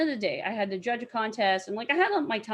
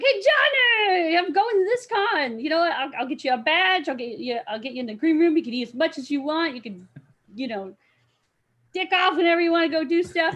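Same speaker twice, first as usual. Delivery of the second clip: excited invite; 6 semitones higher, 5 dB louder than usual. Retold or imitated. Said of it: "I'm like".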